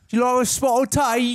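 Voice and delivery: high, loud cartoon British voice; mock British accent. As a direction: squawk it out as a hammed-up Brit. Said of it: "That's how you guys talk, our U K audience".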